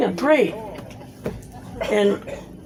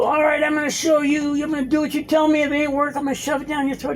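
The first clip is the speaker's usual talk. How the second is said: raised, higher mock shout in an argument; retold yelling; 5 semitones higher than usual. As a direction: throw it back at him like a threat. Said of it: "fighting and arguing and yelling".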